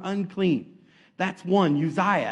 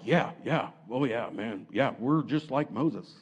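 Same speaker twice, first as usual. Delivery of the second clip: low, soft chiming-in; mock yes-man, understated; agreeing sidekick; 7 semitones lower, 5 dB quieter than usual. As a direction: go along with the boast, pitched down. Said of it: "Aaron's like".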